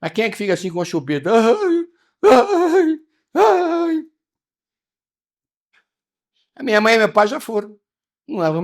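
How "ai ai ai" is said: high, loud hammed-up whimper; mock crybaby. Preacher mocks attached people crying like babies, high fake whimpers. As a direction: whine like a baby with a pacifier. Mocking someone crying with a pacifier.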